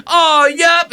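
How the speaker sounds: very high, loud mimic; mocking catchphrase. Host imitating the man beside his date, shrieky mockery. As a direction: blare his catchphrase as a cartoonish impression. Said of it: "He's like".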